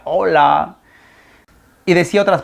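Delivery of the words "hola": echo. Retold or imitated. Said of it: "el cerro me respondía".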